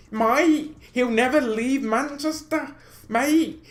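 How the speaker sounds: put-on high, loud Manchester accent, abusive fan; mock Manchester accent. Angry City fan abusing the video maker, mock Manchester accent. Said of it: "I almost did the accent".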